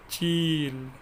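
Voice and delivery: चील with a long, slow, stretched ई; stress on the ई. Shown as a demonstration. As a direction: drag out the ई in चील, teacher demo. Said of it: "थोड़ा ऊंचे स्वर में पढ़ना है". (चील का ई लंबा और धीरे खींचकर)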